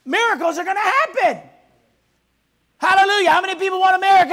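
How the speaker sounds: loud, high, rousing preacher's cry; full-force preaching. Acted. Pastor rousing the congregation, loud full-force preaching. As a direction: whip the congregation up to believe.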